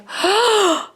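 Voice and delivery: sharp high gasp of shock; imitating mom's startled gasp. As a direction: gasp like a mom catching a thief. Mom sees the stolen Band-Aids.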